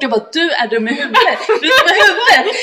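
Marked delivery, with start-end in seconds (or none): 0.3-1.4 mock scolding through a chuckle; half-laughing retold insult. Scolding friend in retold scene. 1.5-2.6 much higher and louder, cracking up; mock outrage dissolving into laughs; laughing yell. Repeating scolding, escalating.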